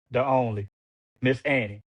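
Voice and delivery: ordinary delivery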